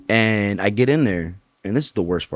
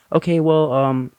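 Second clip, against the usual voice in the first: nurse voice; 8 semitones higher than usual. Retold or imitated.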